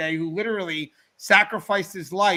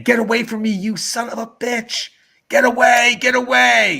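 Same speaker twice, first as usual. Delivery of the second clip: loud, higher mock sleep-scream; mother's nightmare scream; 5 semitones higher, 10 dB louder than usual. Son imitating mom's nightmare, screaming. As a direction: yell it like someone fighting off an attacker. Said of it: "I hear someone screaming".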